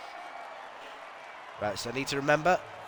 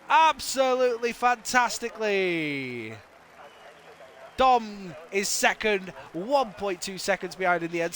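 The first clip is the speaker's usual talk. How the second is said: lively play-by-play recap; live commentary; 8 semitones higher than usual. Acted. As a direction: call the results with energy. Results commentary.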